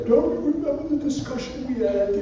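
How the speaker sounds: high-pitched mimicked scolding; mock reproach. They remind president of the discussion, mock reproach.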